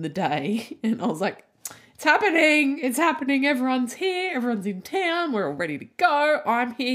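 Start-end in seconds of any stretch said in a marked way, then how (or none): 1.9-6.9 excited. Retold or imitated. Recalls wedding morning excitement.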